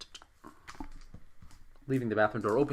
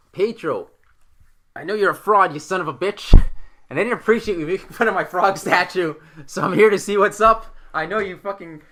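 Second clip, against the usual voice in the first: loud, high, drawn-out indignant rant; acted angry confrontation; 6 semitones higher, 12 dB louder, slower than usual. Player confronting Pietro over his frog statue, mock-angry rant. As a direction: call out the fraud, loud and indignant.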